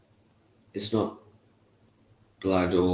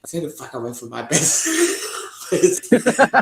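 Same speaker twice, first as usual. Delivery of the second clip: mock threat; 6 semitones higher, 5 dB louder, much slower than usual. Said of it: "he's like, on Facebook".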